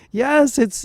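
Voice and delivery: ordinary talk, nothing marked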